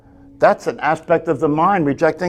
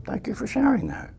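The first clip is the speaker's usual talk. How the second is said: higher, soft and kind; tender, light, quiet acceptance; gentle thank-you; 10 semitones higher, 8 dB quieter than usual. Retold or imitated. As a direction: lift the voice and thank the mind tenderly. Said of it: "and it says".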